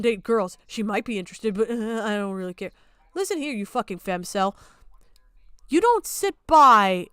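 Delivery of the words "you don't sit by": angry scolding. Real, not acted. Angry rant.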